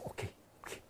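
unvoiced, slow inner whisper; whispered thought. Preacher voices his own nervous thought, whispered slowly. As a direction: breathe it like a nervous thought to yourself. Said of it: "我心想".

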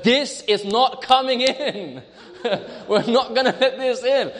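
hammed-up defiant declaration, on the edge of laughing; President Trump. President on television refusing to let the virus in, mock defiant. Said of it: "he's very defiant".

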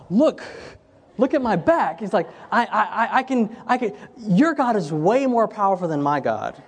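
high, breathless amazement, laughing through it; excited. Retold or imitated. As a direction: blurt it out, amazed and overjoyed. Man excited, back healed.

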